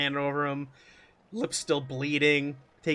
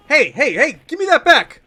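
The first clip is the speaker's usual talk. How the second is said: higher, louder call to get his wallet back; angry demand; 9 semitones higher, 10 dB louder than usual. Acted. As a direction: snap at him to hand it over.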